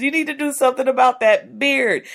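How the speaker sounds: loud, fast, exasperated nagging; nagging spouse. Retold or imitated. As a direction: nag your partner, fed up. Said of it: "the mate just saying".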